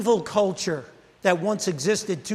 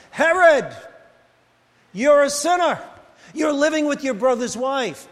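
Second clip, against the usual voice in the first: higher and louder, a fiery prophet calling out; prophet's loud rebuke; 4 semitones higher, 3 dB louder than usual. Retold or imitated. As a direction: thunder it at the king like a street preacher. John preaching from prison.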